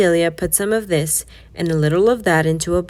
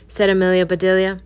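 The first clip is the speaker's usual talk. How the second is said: ordinary delivery, nothing marked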